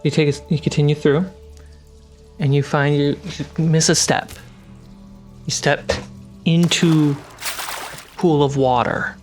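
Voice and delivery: usual speaking voice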